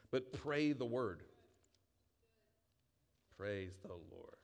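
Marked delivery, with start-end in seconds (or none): 3.4-4.4 soft praise. Real, not acted. Quiet devotional interjection.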